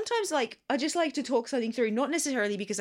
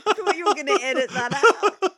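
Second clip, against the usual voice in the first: laughing, higher and louder; 7 semitones higher, 7 dB louder than usual. Real, not acted. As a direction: protest through laughter. Laughing repeat of protest.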